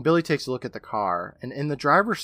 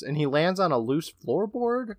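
usual speaking voice

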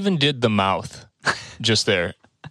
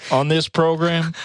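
Southern drawl; 5 semitones higher than usual. Pretend. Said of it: "I'm only talking like this from now on".

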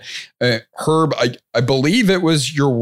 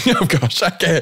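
normal talking voice

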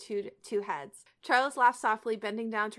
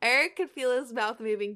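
ordinary delivery

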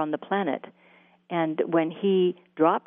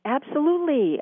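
a higher, eager "Absolutely" that jumps up at the start; eager yes; 8 semitones higher than usual. Retold or imitated. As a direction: blurt out "Absolutely" high and keen. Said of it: "ab-, I was".

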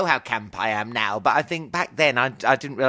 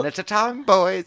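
camp flirt; 8 semitones higher, 5 dB louder than usual. Pretend. Camp flirt with holiday men.

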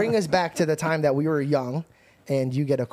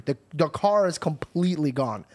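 ordinary delivery

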